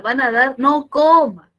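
high, emphatic comic outcry; loud mock warning. Teacher warning a would-be swimmer off ceviche, loud mock alarm. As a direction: shout it like an urgent don't-do-it.

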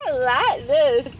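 numb mouth, drugged and slurry; after wisdom teeth. Real, not acted. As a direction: talk through a numb, swollen mouth.

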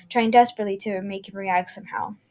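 ordinary delivery

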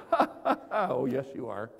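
laughing it off, amused; laughing quote. Retold or imitated. Best friend laughing off his cancelling, amused. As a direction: laugh away the fear.

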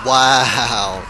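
loud startled wow; surprised shout. Real, not acted. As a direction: shout in shock at a headshot. Shouted reaction to headshot.

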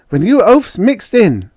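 loud, high retort; angry snap. A narrator's reading. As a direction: snap at the meddling men. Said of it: "Judy snapped".

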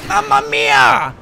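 high, drawn-out shout of "Mamma mia"; stretched, high-pitched mock outburst; mock Italian exclamation. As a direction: belt it out like a cartoon Italian.

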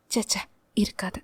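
soft, quick dismissive muttering; muttered to herself. Acted. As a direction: brush the idea away under her breath. (தனக்குள் முணுமுணுப்பு)

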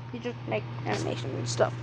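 fast whispered afterthought; whispering. Real, not acted. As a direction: rush it in a whisper.

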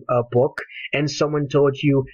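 normal talking voice

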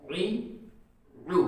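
ई and उ with a throaty ain onset; throaty ain vowels. Shown as a demonstration. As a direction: voice ई, उ from mid throat. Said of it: "और आइन की आवाज़ आएगी". (आइन वाली ई, उ)

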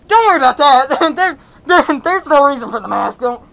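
loud, nervous, stumbling cover-up; flustered denial. Acted. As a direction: blurt it out too loudly, hiding something. Flustered cover-up about the mask.

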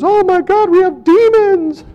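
high, loud imitated scream; wife's scream. Retelling wife's reaction to the recorder, mock panicked scream. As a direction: cry it out high and loud, stretching the end. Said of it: "My wife screamed and goes".

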